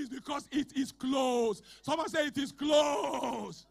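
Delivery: loud, shouted declaration; preaching at full force. Acted. Pastor decreeing over the congregation, loud shouted preaching. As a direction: thunder the blessing over them.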